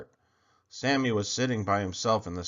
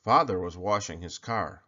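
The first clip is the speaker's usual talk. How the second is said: usual speaking voice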